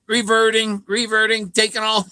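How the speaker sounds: lightly voiced computer, announcing failure; mock computer voice. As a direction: imitate the update giving up. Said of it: "and the computer's".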